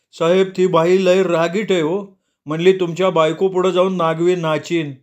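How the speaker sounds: a near-crying constable reporting to boss; whimpering. Acted. Weeping policeman complains to his boss. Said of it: "रडत रडत म्हणला".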